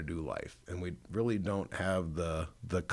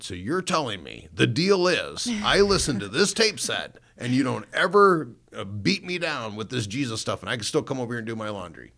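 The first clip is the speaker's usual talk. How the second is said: loud, high, animated retelling; incredulous bargaining; 5 semitones higher, 8 dB louder than usual. Retold or imitated. Son confirming the tape deal with mom, loudly incredulous. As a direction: spell out the terms with mock disbelief.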